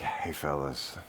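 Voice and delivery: very soft, low aside; hushed, low-key call; gentle interjection. Retold or imitated. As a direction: get their attention quietly, voicing Jesus. Jesus quietly addresses the accusers.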